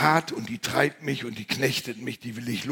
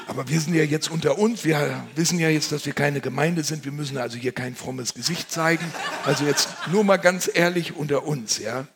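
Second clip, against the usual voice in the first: faster, louder, with laughter; laughing aside; 3 semitones higher, 5 dB louder than usual. Acted. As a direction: let them in on a secret, chuckling.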